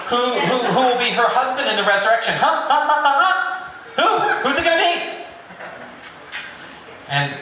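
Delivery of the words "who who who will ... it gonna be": taunting Sadducees. Retold or imitated. Sadducees taunting with trick question.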